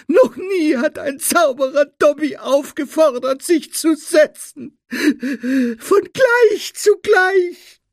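very high, loud elf wail; sobbing. A narrator's reading. Elf never treated as an equal, sobbing shrilly. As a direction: wail tearfully, dragging out the end. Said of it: "schluchzte der Elf".

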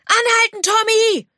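high, urgent yell to stop; panicked call, pitched right up; shouted command. A narrator's reading. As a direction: shout a halt to a runaway animal. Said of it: "rief er".

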